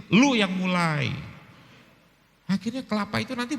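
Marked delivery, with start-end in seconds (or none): 0.1-1.1 louder snapping comeback; mock angry retort. Wife answering husband in a quarrel, mock angry retort. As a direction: fire it back, half-playful.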